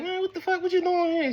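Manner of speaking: very high startled demand; can't believe he's standing there; shocked mother. Retold or imitated. Mother asking why father is home.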